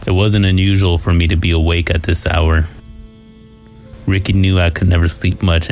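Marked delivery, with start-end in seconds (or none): none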